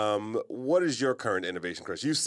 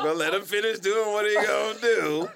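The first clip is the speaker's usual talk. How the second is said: resigned mom; 7 semitones higher, 4 dB louder than usual. Retold or imitated. Mom letting burglar finish.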